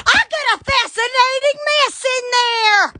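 high, hollered announcement; excited exclamation. Real, not acted. Exclaims over the mess.

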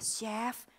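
soft call to the chef; quiet call. Retold or imitated. Hostess timidly calls the chef in the kitchen, soft. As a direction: call softly, unsure. Nggak denger-denger.

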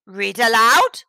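loud, incredulous rising question; puzzled. A narrator's reading. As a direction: echo it back loudly, rising in disbelief. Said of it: "asked the puzzled".

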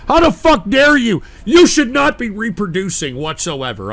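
real rage shouted at a caller; furious rant. Real, not acted. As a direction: tear into him at full volume. Raging at insulting caller.

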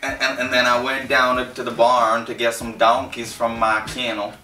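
put-on country Tennessee accent; Deep South accent. Telling of a day on the farm, mock Deep South accent. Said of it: "I wanna hear Deep South, Country America".

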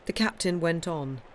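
usual speaking voice

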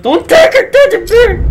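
laughing shriek. Real, not acted. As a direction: shriek it while cracking up. Friend laughing, screaming warning.

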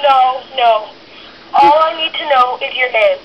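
ordinary delivery

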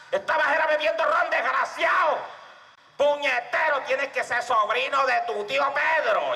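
high, shouted tirade; wife's scolding. Acted. Wife berates the husband home from the bullring, high angry shout. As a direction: scold high, dragging the final insult.

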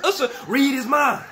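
yelling at the screen; excited shouting. Real, not acted. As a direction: chant it loud and high at the screen. Shouting chant again, laughing.

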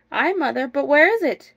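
lively storybook husband; urgent questioning. Acted. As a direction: ask as you hunt around for it. Husband looking for bannock urgently.

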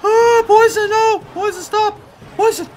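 high panicked shrieking; frantic high yelps; raging gamer. Real, not acted. Panicking as poison hits.

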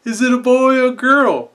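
put-on high sweet old lady. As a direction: coo like a doting grandma. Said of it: "she asks the question".

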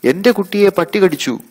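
very high, loud alarmed shriek; panicked grandmother crying out loud and high; grandmother screams. Acted. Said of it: "മുത്തശ്ശി വിളിച്ചു കൂവി".

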